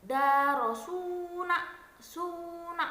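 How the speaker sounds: Darosuna with suna drawn out slow and high; stress on last syllable. Shown as a demonstration. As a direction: stretch the final suna, stressed. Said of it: "Tekanan terdapat pada suku kata akhir". (tekanan pada suku kata akhir)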